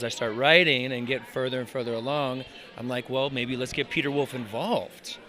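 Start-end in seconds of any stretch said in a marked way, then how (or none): none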